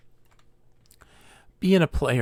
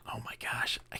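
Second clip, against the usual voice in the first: whisper; 3 semitones lower, 15 dB quieter than usual. Real, not acted. Forgetting the name mid-stream.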